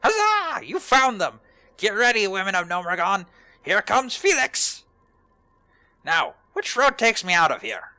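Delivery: high, loud, triumphant hero voice; excited gnome adventurer. Acted. Gnome hero hailing the women of Gnomeregan, loud and jubilant.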